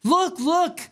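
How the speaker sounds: high, excited mock of someone waving a letter; mocking imitation. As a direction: lampoon them pushing a letter at you. All they gotta do is this.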